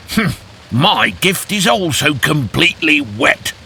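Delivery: high, sulky hedgehog voice; disappointed hedgehog. Acted. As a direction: grumble it like a let-down hedgehog.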